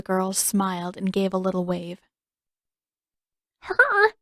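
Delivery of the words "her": high, squeaky disbelief; a startled squeak of disbelief. A narrator's reading. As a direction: squeak it out in shock. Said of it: "Sokka squeaked".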